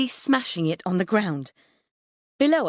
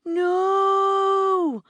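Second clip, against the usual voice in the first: very high, drawn-out wail; long yell; 14 semitones higher, 3 dB louder than usual. A narrator's reading. Neighbour boy seeing the computer in danger, yelling. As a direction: yell one long horrified cry. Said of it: "actually yells".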